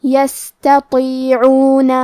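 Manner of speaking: یستطیعون very slow, light ت then heavy ط; slow tajweed demo of یستطیعون; heavy taa. Shown as a demonstration. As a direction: read یستطیعون slowly with a full heavy ط. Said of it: "حرف تو کو بھاری آواز میں پڑھنا ہے".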